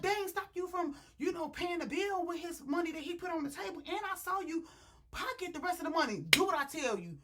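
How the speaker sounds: high, loud mock scolding; bossy girlfriend. Girlfriend ordering her man home after dinner, bossy.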